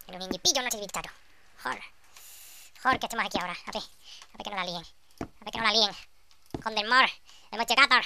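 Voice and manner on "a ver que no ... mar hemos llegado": ordinary talk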